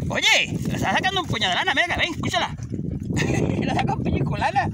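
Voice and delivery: shepherd alarmed at the dog. Real, not acted. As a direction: react to the dog pulling wool. Dog biting sheep's wool.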